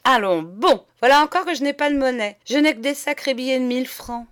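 loud, fast put-on astonishment; hammed-up fake surprise at having no change; feigned surprise. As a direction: act caught out, rattling it off quickly. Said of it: "et simulant la surprise, il s'écria".

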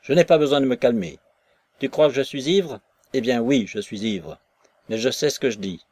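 loud and fast, all in one breath; drunk defiance. Acted. Drunk Maslova retorts to Nekhludov in one loud rush. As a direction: fire it back at him in one go. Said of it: "répliqua-t-elle d'un seul trait".

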